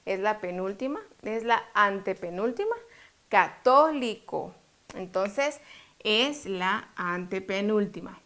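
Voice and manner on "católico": "Católico" slowed, weight on "tó"; showing the stressed syllable. Shown as a demonstration. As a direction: sound out "Católico" slowly, leaning on "tó". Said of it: "Cuál es la sílaba tónica". ("Católico" lento, con fuerza en "tó")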